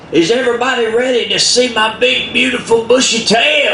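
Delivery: high, calling out to the crowd; showman Possum. Acted. Possum hyping the animals from the stage, high showman call. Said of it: "He says".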